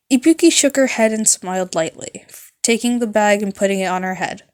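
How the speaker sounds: ordinary delivery, nothing marked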